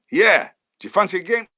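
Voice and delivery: loud, high and abrupt; booming gaffer. Retold or imitated. Manager offering young player a game, booming. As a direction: bellow the offer down the phone.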